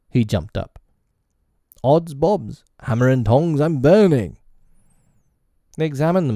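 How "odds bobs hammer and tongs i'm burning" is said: loud, high yelp of alarm; alarmed shout. Acted. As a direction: yelp as the seat burns. Hook jumps off hot seat.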